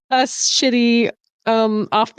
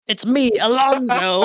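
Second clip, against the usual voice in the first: circus clown voice. Acted. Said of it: "you do sound like a circus clown".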